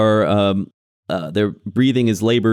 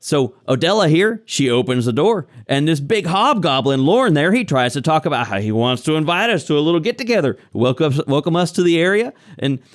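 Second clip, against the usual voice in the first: higher-pitched, rambling villager; nervous captive farmer; 5 semitones higher than usual. Acted. Captive man recounting the knock at his door, rattled. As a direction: tell it as a rattled homesteader.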